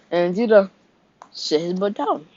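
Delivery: cracking voice mid-sentence. Real, not acted. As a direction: voice cracking up high. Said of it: "that was a major voice crack".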